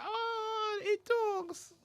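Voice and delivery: high falsetto coo of wonder; amazed girl onlooker. Acted. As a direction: gush like a girl charmed by a talking bird. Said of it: "and they're like".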